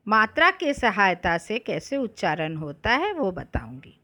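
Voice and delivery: usual speaking voice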